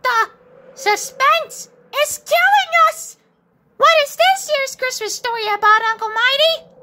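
high, loud, excited squeal; impatient excitement. Acted. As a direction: burst with impatience for the story. Impatient child begs for story.

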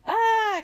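high, loud storybook scream. A narrator's reading. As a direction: cry out high and loud in alarm. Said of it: "screamed Alexa".

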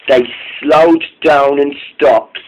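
ordinary delivery

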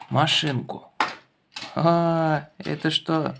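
ordinary talk, nothing marked

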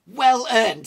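loud, high-pitched indignant echo; scoffing cry; incredulous outburst. A narrator's reading. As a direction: throw the word back in disbelief. Gimli scoffs at Pippin's claim.

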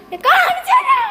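mocking the child's I'll show you in a high loud voice; mock child threat. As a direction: parrot the boy's threat in a shrill kid voice.

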